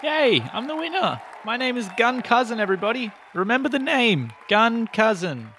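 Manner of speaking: high, gleeful winner's crowing; triumphant boasting. Acted. Gun claims the win before everybody, high triumphant boast. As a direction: crow your name high to the crowd. Gun celebrates winning to the crowd.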